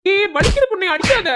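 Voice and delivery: high, loud cry of pain; yelping as she hits him. Real, not acted. Husband being hit by wife. (வலியில் கத்துதல்)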